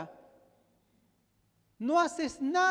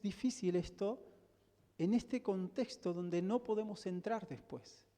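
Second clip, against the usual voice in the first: softer, lower, drawing the congregation in; hushed and sober; 5 semitones lower, 8 dB quieter than usual. Real, not acted. Pastor drawing the congregation to a sober close, softer and lower. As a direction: let the voice sink to a grave hush.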